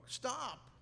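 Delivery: sharp high shout of stop; yell. Acted. Butcher yelling to stop the angry man, high shout. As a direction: call out to halt the angry man. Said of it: "yells the butcher".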